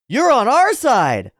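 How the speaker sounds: high, loud, drawn-out cry; exclaiming character. Acted. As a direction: shout it out slowly in disbelief. Character shouts at newcomer.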